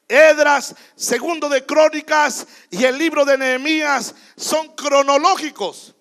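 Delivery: predica fuerte al pueblo, con fuego y alzando la voz; proclámalo como pastor desde el púlpito; predicación con fuerza. Acted. (predicador a la congregación, con voz fuerte y apasionada)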